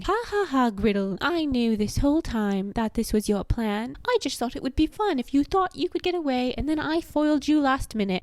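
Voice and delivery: high posh British villain cackle into a gloat; hammed-up posh British taunting. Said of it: "she's talking in a posh British accent, quite high-pitched".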